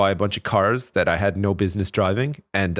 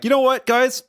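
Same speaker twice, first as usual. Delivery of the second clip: high, giddy announcement to friends; gleeful big spender; excited; 13 semitones higher than usual. Pretend. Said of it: "And I'd be like".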